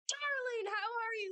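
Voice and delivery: high, gushing acted hello to an old flame; overeager greeting. Captain greets Pearl after twenty years.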